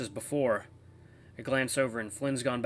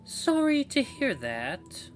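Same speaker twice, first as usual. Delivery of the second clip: high, slow falsetto woman; woman's voice; 13 semitones higher, much slower than usual. Acted. Radio voice answers the narrator's thoughts, high woman's voice. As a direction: put on a drawn-out, high-pitched woman's voice. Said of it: "let's do a woman's voice".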